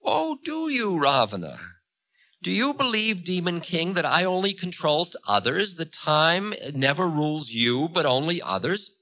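high, indignant challenge as Time; Time, taunting. A narrator's reading. Time challenging the Demon King, taunting. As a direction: lift the voice into a taunting question.

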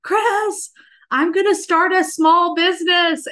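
excited high call to her husband; screamed out. Retold or imitated. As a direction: shout the news to her husband in another room. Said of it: "So I screamed out to Chris".

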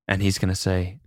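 ordinary talk, nothing marked

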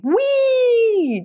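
loud, very high whoop of joy; gleeful whoop. Retold or imitated. Old man on rocket skateboard whooping gleefully. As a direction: shout it like a kid on a ride.

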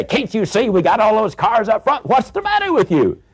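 high-pitched exasperated driver; indignant yelling. Acted. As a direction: protest at the honker behind, pitch shooting up. Acted driver snapping at honker.

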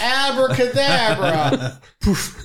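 slow, high showman flourish; grandly drawn-out magic word; magician voice. Pretend. Mock magician trick.

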